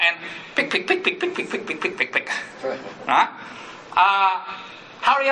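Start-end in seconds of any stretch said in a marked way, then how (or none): 0.5-2.3 nagging pick pick. Acted. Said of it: "inner auto monster nagging".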